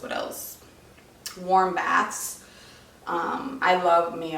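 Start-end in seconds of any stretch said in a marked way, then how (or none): 0.0-0.6 a soft whisper while thinking of the next tip; whispered to herself. Real, not acted. Mom pausing her pregnancy tips list, whispering to herself. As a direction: whisper it to yourself, searching your memory.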